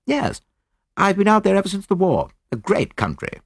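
put-on high-pitched young officer; young soldier. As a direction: answer readily in a lifted, youthful voice.

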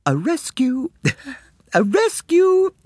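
put-on very high girlish cry broken by giggles; giggling gasps. A narrator's reading. Two girls running out, high giggling cry. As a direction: cry out, gasping between giggles. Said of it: "gasping in between giggles".

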